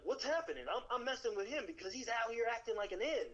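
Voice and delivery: imitating a defensive shipmate; mock indignant sailor. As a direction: act the caught-out bully, protesting. Quoting indignant Atlanta sailor.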